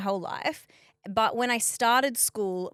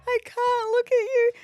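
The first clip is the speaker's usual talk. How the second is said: laughing; 13 semitones higher, 7 dB louder than usual. Real, not acted. Laughing at costume.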